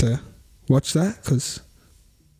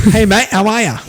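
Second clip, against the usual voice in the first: higher, louder mock American greeting; hammed-up American accent, cheery; 6 semitones higher, 4 dB louder than usual. Co-host trying an American accent and failing. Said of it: "I'm trying to do an American accent".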